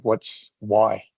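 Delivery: ordinary talk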